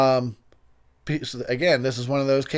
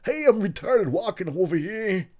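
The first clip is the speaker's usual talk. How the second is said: really bad, high-pitched actor impression; hammed-up, deliberately clumsy mimicry of the actor; Christopher Walken; 7 semitones higher than usual. Mocking his own slip with a really bad Walken impression. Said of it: "do a really bad impression".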